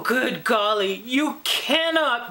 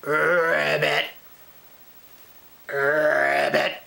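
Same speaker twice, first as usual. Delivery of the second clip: frog croak; 8 semitones lower than usual. Acted. Turned into a frog.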